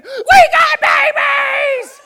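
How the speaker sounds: a high, very loud, drawn-out shout of joy; excited scream. Retold or imitated. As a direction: yell it across the farm, slow and thrilled. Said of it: "Amber starts screaming".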